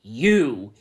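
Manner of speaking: loud, accusing burst; angry. Acted. Aragorn turning on Svetlana, a loud angry you. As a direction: spit the word out in anger. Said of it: "he realized angrily".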